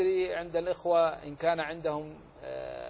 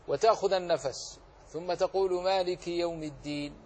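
usual speaking voice

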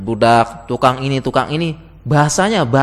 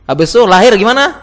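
loud rhetorical question; 7 semitones higher, 7 dB louder than usual. Real, not acted. Teasing audience with rhetorical question.

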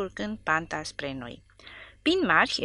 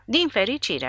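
furious; 6 semitones higher, 3 dB louder than usual. A narrator's reading. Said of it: "a zis bătrânul furios".

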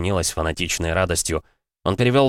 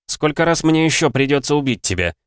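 angry; 9 semitones higher, 4 dB louder than usual. A narrator's reading. Fighter confronts enemy who will not die.

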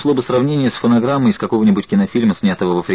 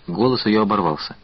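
plain reading voice

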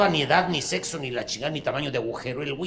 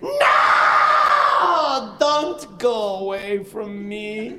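shrieking soap mother; 8 semitones higher, 5 dB louder, much slower than usual. Acted.

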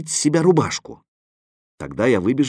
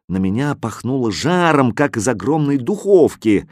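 ordinary delivery, nothing marked

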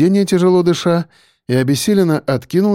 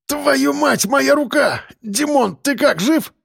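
high, pained shouting; alarmed cry; 11 semitones higher, slower than usual. A narrator's reading. Injured driver calling to his unconscious friend, alarmed cry. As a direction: cry out hurt, then anxious. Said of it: "крикнул Белобрысый".

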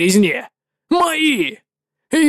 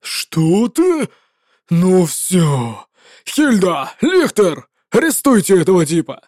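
indignant commander shouting down a rival; a general who refuses to share the glory; furious legate; 2 semitones lower, slower than usual. Acted. Legate orders an arrest.

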